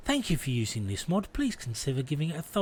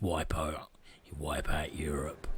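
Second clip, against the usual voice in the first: hushed, breathy menace; whisper; 8 semitones lower, 7 dB quieter than usual. Pretend. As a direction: whisper it like a secret plan. Mock sinister whisper of conquest.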